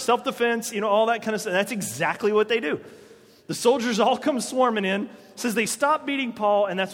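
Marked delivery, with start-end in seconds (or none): none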